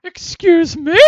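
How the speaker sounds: high, loud indignant lady like Eric Idle. Acted. Lady refusing the sweep, like Eric Idle in drag. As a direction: squawk it like a Python housewife. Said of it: "You sound like, um, Eric Idle".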